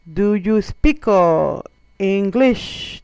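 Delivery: loud, over-spelled question, word by word; spoon-feed each word loudly to a newcomer; talking to a foreigner. Retold or imitated. Locals addressing a foreigner, loud and laboured. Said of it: "the way they talk to you".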